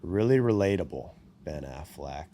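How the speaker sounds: dry, low mocking sarcasm; mock him with quiet sarcasm; sarcastic. Real, not acted. Sarcastic dig at Ben Affleck.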